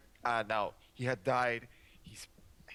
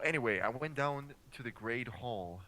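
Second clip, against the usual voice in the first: usual speaking voice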